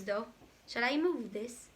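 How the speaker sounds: usual speaking voice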